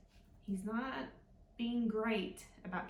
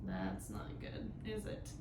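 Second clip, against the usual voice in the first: ominous low voice; 14 semitones lower, 8 dB quieter than usual. Shown as a demonstration. Storyteller warns of trouble.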